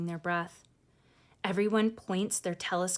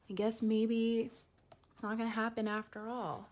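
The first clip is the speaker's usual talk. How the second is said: disappointed; 4 semitones higher, 5 dB quieter than usual. Retold or imitated. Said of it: "She's starting to feel like".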